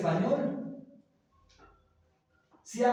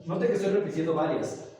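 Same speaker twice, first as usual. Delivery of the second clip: ordinary delivery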